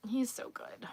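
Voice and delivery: breathy whispered aside. Real, not acted. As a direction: drop to a whisper for a quick aside. Whispered aside mid-chat.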